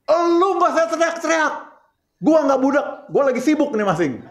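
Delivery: high, put-on snapping wife; hammed-up busy wife scolding back; a wife snapping at her husband after guests leave; mock angry wife. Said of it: "jadinya ribut".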